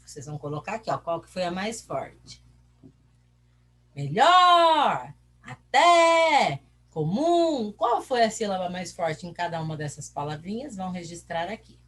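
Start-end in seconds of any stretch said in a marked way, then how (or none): 3.9-7.7 high, loud calls with the last syllable held long; calling from afar. Shown as a demonstration. Teacher repeats for pupils to find strong syllable, calling out. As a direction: call each word as if hailing someone distant. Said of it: "chama longe a palavra".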